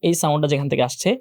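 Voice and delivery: ordinary delivery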